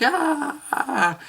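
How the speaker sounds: high mock wail of sobbing; mock crying. Telling mom how hard he will cry, in a hammed-up sob. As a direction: ham up a teary wail, pitched high. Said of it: "I'd be like".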